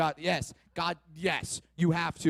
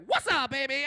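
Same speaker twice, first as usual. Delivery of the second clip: higher, smug pickup line; cocky swagger; 8 semitones higher, 3 dB louder than usual. Acted. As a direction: toss it off like a smooth player. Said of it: "I'm just like".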